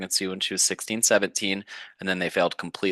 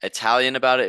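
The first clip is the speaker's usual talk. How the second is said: ordinary talk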